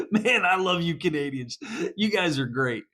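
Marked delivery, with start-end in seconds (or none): none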